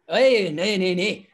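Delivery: mock Borders accent, words mashed by a mouthguard; mumbled Borders accent. Referee mimicking Greg Laidlaw's garbled Borders accent with gum shield in. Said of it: "that Borders accent that can be quite tough to understand when they got the gum shield in".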